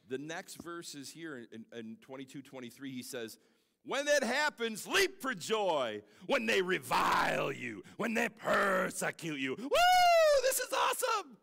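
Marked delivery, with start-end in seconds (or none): 3.8-9.6 high, loud, slow proclaiming; voicing Jesus' command in a loud preaching shout. 9.6-11.4 much higher, loud joyful cry; real delight at the scripture, whooping; exultant whoop. Real, not acted. Preacher's exultant outburst.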